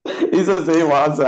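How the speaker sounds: spoken through laughter; amused, chuckling line; laughing. Real, not acted. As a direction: laugh as you say it. Laughing over the answer.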